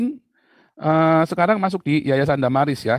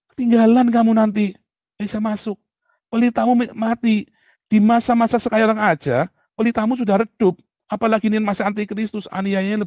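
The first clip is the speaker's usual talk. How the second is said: raised, higher preaching warning; loud warning; 6 semitones higher, 6 dB louder than usual. Real, not acted. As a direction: call it out as a stern alarm. Warning congregation their lamp dies.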